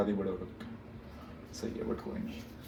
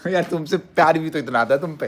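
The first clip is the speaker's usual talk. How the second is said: laughing; 6 semitones higher, 6 dB louder than usual. Real, not acted. Breaks from mock rage laughing.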